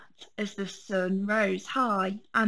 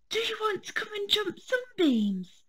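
put-on much higher, lilting young fawn; high fawn inviting Fred to play; little deer voice; 12 semitones higher than usual. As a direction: ask like a bouncy little deer.

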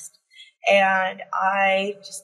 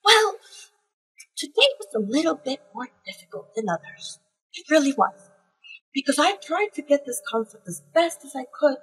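a higher, lightly voiced older colleague, wistful; imitating Ian; 6 semitones higher than usual. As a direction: play a gentle mentor admitting a rough day, pitched up. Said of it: "And he's just like".

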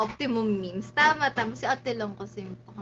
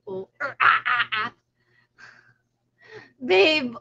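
usual speaking voice

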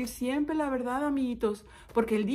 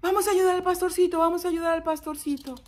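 high, fast rallying call; villagers hurrying; 6 semitones higher, 3 dB louder, faster than usual. Acted. Villagers dropping work to help the shepherd, urgent. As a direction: hurry everyone along, high and quick.

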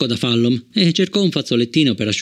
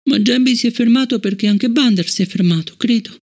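mild moan; 10 semitones higher than usual. Acted. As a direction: hands over her eyes, grieving.

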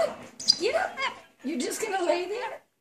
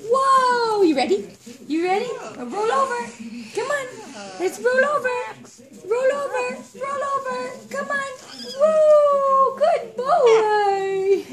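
excited cheering; 5 semitones higher, 7 dB louder than usual. Real, not acted. Cheering bird's trick.

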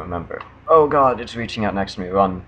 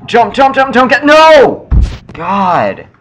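shrieking then despairing no; high panicked scream; being chased; 10 semitones higher, 11 dB louder, slower than usual. Real, not acted. Frantic jump, dies.

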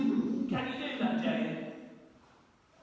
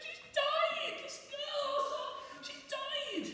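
high, insistent mourners; mourners' voice; much slower than usual. Acted. Mourners telling Jesus the girl is dead, high and insistent. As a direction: pitch way up to play the crowd.

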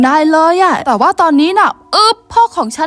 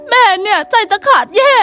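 higher, worried mother voice; fretful mother; 6 semitones higher than usual. Acted. Mother tells the late child how worried she was, fretful. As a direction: let the worry lift the voice.

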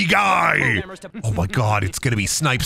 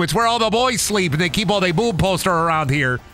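put-on Minnesotan accent. Streamer voices a Minnesotan dock worker enemy, Minnesotan accent. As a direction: lean into the Minnesota "oh, dude" lilt. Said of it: "Minnesotan dock workers".

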